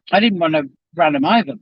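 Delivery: usual speaking voice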